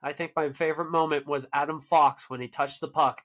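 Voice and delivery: ordinary talk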